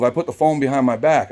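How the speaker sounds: plain reading voice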